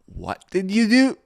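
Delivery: high, loud angry demand; angry friend. Retold or imitated. Best friend angrily confronting him about the jump. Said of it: "And he was really angry".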